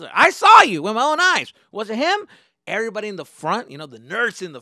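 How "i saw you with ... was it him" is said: high, shouted accusation; angry accusation. Retold or imitated. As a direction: accuse him at full volume. Bus driver accuses him.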